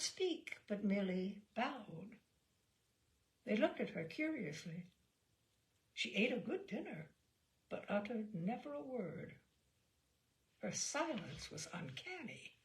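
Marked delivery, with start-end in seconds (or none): none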